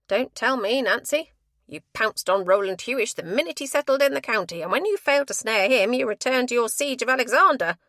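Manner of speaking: loud, sneering matron; scornful accusation. A narrator's reading. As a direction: lay out her scheming with scorn.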